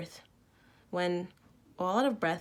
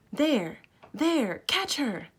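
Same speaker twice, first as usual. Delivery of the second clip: sharp high shouts, much louder; screaming; 8 semitones higher, 6 dB louder, much slower than usual. A narrator's reading. Friends spot the hiding girl, scream to catch her. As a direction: yell it out to stop a running thief. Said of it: "I screamed".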